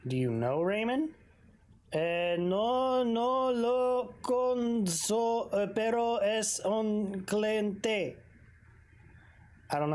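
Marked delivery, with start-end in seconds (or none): 1.9-8.2 put-on slow, drawn-out Spanish-accented mechanic. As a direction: let the Spanish roll slowly. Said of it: "why am I giving him a weird accent".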